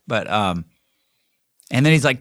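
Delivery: ordinary talk, nothing marked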